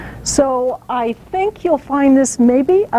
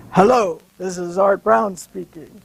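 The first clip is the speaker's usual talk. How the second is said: a lightly lowered take on another man's voice, his name stressed; slightly lower, put-on boss's phone voice; Art Brown impression; 5 semitones lower than usual. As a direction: mimic the station boss answering the phone. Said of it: "in a perfect imitation of Art Brown's voice".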